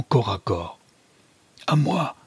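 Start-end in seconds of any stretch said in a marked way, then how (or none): 1.7-2.2 cry for help. A narrator's reading. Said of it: "crie le capitaine Harlan".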